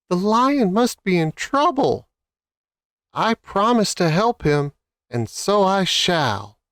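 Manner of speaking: high, resolute little mouse. Acted. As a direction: squeak it out bravely.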